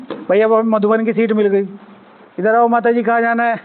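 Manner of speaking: ordinary talk, nothing marked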